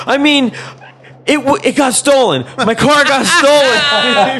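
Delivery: high, loud mock-panicked explanation; flustered backpedaling. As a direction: imitate a friend frantically correcting himself, drawing out the end. Retold friend's flustered clarification to cop.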